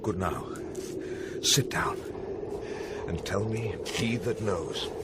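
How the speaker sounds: low, soft, measured invitation; hushed request. Acted. Sentry seating companions to ask for answers, hushed. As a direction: settle them down quietly before asking.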